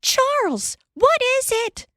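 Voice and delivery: a woman roused from sleep, alarmed; Ma waking. Acted. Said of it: "She heard Ma say".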